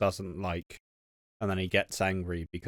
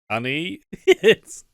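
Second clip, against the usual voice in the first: giggling through a teasing husband line; husband breaking into laughter while announcing a new trick; laughing mock husband; 6 semitones higher, 8 dB louder than usual. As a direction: laugh your way through it, voice jumping higher. Acted husband quote, laughing.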